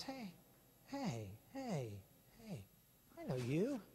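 soft, wary calls, then I know you; quietly single him out; hushed accusation. Retold or imitated. Servant at the fire recognising Peter, hushed suspicious call.

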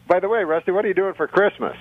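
usual speaking voice

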